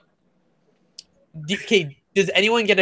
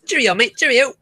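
high, chirpy mock-British farewell; mock British accent; 8 semitones higher, 3 dB louder than usual. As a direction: toss out a high-pitched British goodbye. Playful British goodbye after Cockney talk.

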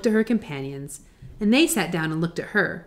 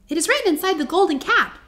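high-pitched mouse queen; squeaky little voice; 9 semitones higher than usual. A narrator's reading. Queen of the Mice answering Dorothy, squeaky. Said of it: "in her squeaky little voice".

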